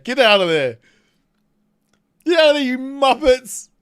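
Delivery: loud, high yell at bots; shouting. Real, not acted. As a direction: holler at them to move. Yelling at game bots.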